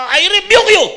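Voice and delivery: high, loud command; commanding rebuke. Shown as a demonstration. Jesus rebuking the storm, loud command. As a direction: rebuke it with full force. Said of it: "ini-imagine natin".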